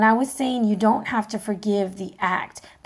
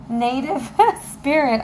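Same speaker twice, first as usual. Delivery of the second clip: laughing through the card name; a laugh breaks the name; 4 semitones higher than usual. Real, not acted. To viewers, recalling the deck name, laughing. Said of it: "I can't think".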